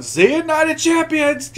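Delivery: high-pitched parting yell. Retold or imitated. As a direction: shout it up high, gleeful. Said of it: "he's just yelling".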